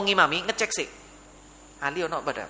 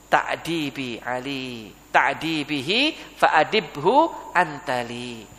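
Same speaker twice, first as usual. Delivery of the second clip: slow, low Arabic recitation; humble supplication; 6 semitones lower, much slower than usual. Acted. Father hands his son's upbringing to God, slow humble prayer. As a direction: recite it slowly, stretching each phrase low.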